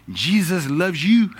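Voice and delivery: put-on high, slow French-accented "Jesus loves you"; broken French English. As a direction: mimic her halting French accent, tenderly. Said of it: "in her broken English".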